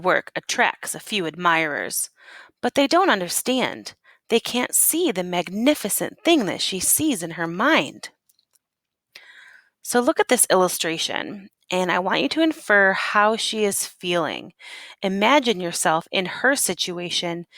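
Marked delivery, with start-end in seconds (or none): none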